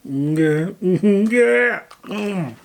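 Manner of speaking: high, loud yeahs while chewing; excited approval. Real, not acted. Snacker loving the cookies, high loud excited yeahs. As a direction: squeal your approval through a mouthful.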